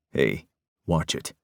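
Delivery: a quiet threat, slowed down; low warning. Acted. Peyton warns Parker to watch his tongue, low and slow. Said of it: "Peyton warned".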